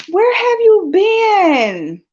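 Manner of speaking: very high, long drawn-out wail; overjoyed cry. Real, not acted. To a friend missing for ages, overjoyed wail. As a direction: wail the question out, stretching every word.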